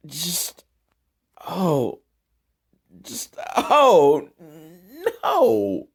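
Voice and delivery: high, despairing whimper; whining in comic despair; exasperated whine. Real, not acted. Exasperated by a bad film.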